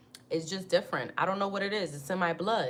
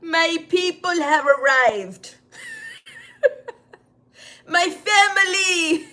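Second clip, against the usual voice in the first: mock joyful greeting; 11 semitones higher, 9 dB louder, slower than usual. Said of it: "I was like this".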